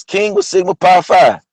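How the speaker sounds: ordinary delivery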